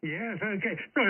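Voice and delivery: usual speaking voice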